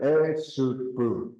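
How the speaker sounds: slow drawn-out Esupu; teaching word. Shown as a demonstration. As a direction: say Esupu slowly, syllable by syllable. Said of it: "Say that".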